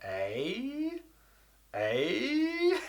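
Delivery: long, soft "eh" sliding way up high; exaggerated, drawn-out puzzled squeal; baffled. Shown as a demonstration. Not understanding a friend's instructions, comically baffled. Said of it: "I just don't understand".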